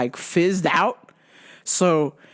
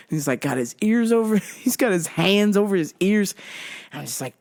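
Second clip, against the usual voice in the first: ordinary talk, nothing marked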